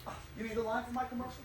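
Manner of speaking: ordinary delivery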